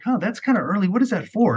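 high, puzzled self-quote; puzzled surprise. Retold or imitated. Acts out puzzled discovery at bank account.

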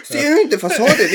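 squealing with laughter; laughing. Real, not acted. Joking back while laughing.

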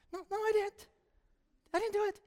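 high, small, soft protest; caught-out denial. Acted. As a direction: squeak out a guilty denial. Acting Sarah caught out, denying it.

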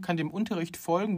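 usual speaking voice

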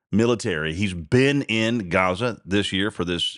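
normal talking voice

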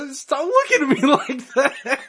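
high, slow, laughing plea; can't keep a straight face. Real, not acted. Laughing at friend's look.

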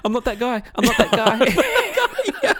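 high, hammed-up pleading that tips into laughter; mock defensive protest. Listener caught in someone's retold row, mock defensive. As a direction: protest as if wrongly attacked, pitched high. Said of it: "They're totally re-experiencing the moment".

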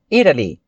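"Italy" with T between D and R; American flapped T. Shown as a demonstration. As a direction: tap the T lightly in "Italy". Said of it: "puxa mais esse T com um som".

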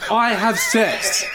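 high, slow, breaking into a laugh. Acted. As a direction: crack up mid-claim, drawn out. Carpenter laughing at his own claim.

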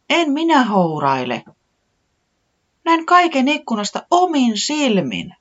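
high, put-out protest; insist, slightly offended; a little indignant. A narrator's reading. Tiputi insisting to Taputi she saw it, slightly indignant. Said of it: "vähän närkästyneenä".

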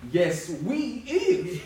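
slow, chuckling playful repeat; laughing drawl. Pretend. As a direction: drag it out through a laugh.

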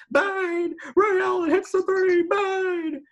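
very high, loud, excited three-point call; Mike Breen impression. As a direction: explode on each bang like a TV play-by-play man. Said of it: "steal, like, Mike Breen's".

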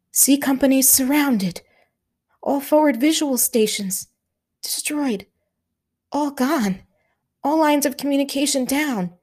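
a hint of a tense Highland officer; Scottish officer Hamish. A narrator's reading. Hamish briefing Stanley under shellfire, lightly voiced.

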